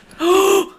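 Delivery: sharp high loud gasp; shocked gasp; startled gasp. Acted. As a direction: gasp as if hit from behind. Something hits back.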